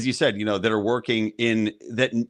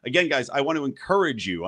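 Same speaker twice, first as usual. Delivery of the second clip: ordinary talk, nothing marked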